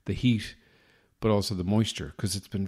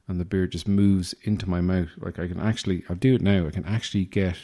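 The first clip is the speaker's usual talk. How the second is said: ordinary talk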